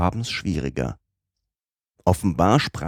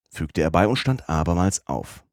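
usual speaking voice

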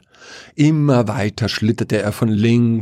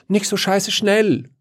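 high, pitched-up call; 12 semitones higher than usual. A narrator's reading. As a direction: call it out sharply. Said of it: "rief Wiesel". (hoher Ausruf)

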